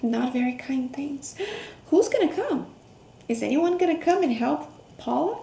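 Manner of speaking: usual speaking voice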